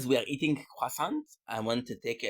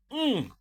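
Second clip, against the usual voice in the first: rising delighted mm; savouring a hot buttery croissant; high moan of pleasure; 13 semitones higher, 5 dB louder than usual. Real, not acted. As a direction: moan over the taste. Tasting croissant.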